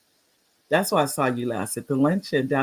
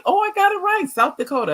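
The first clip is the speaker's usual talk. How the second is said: high-pitched, pleased exclamation; thrilled little burst; delighted; 3 dB louder than usual. Real, not acted. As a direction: celebrate getting it right, voice jumping up. Delighted at guessing the state right.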